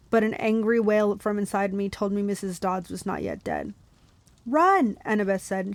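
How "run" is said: high, loud command; urgent call. Acted. Annabeth warning her friends to flee, urgent shout. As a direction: call out to make them flee.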